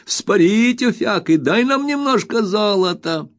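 put-on high, jeering kids' chant; teasing street boys. A narrator's reading. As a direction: taunt him like cheeky boys at the shop door. Taunting boys shouting at shopkeeper.

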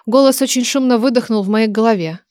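ordinary delivery, nothing marked

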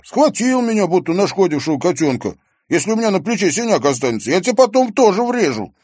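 high, loud, rushed threat; worked-up boyish outrage; heated complaint. A narrator's reading. Boy confronts the one who grabbed him.

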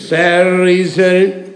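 loud, drawn-out indignant "Сэр рыцарь"; gruff slow address. Acted. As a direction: draw it out loud, bristling with pride. Седрик гордо осаживает рыцаря. (громко, медленно, с гордым возмущением)

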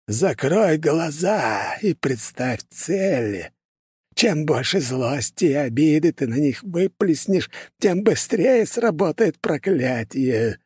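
put-on high, slow, creepy old sorcerer; eerie voice. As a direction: drag each word out like a ghoulish old man. Sinister old curse-maker voiced.

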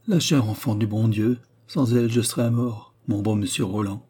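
ordinary delivery, nothing marked

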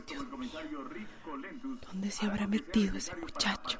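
very soft, slow whispered worry. Acted. Mother alone, worried about her missing son, whispering. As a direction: whisper the fear to herself, barely voiced.

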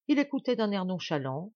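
ordinary delivery

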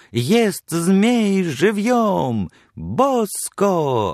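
slow, high barker's cry stretching each word; loud carnival barker. Retold or imitated. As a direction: shout to lure people into the booth. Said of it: "подражая крику ярмарочного крикуна".

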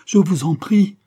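very high, loud, rising entreaty; desperate climactic plea. Acted. Mother begging the men for mercy, desperate cry. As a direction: cry the plea out, pitch leaping upward.

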